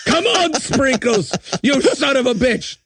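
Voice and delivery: hammed-up action hero shouting over a fallen elf; mock war hero. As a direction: plead with a dying buddy, hammed up. Imagined Santa war flashback.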